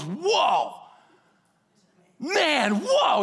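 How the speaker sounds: awestruck, climbing high on the last whoa; Adam seeing Eve, jaw dropped; amazed whoa. Retold or imitated. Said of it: "He goes".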